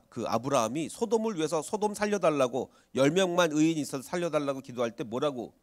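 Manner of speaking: ordinary delivery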